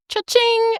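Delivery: high sing-out, mock greedy; gleeful cash-register cry. Bad investigator hearing she has money, mock gleeful. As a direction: ring it up high like a till.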